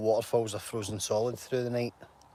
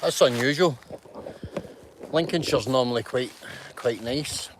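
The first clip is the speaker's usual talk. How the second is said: ordinary talk, nothing marked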